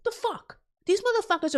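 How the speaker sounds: high, disbelieving blurt; can't believe what she just heard; incredulous. Real, not acted. Ranting streamer reacts to Congress insider trading, incredulous.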